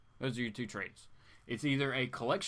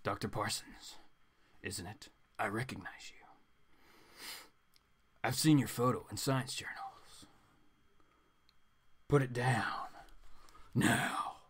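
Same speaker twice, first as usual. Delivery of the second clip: low, soft whispered threat; quiet commanding whisper; 3 semitones lower, 5 dB quieter than usual. Acted. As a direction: hush the order like a shadowy hero. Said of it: "a voice that is quiet and commanding".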